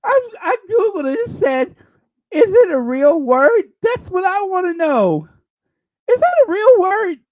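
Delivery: high, loud, worked-up disbelief; incredulous outburst. Real, not acted. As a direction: protest loudly, pitched right up, after laughing hard. Cracked up, disputes word is real.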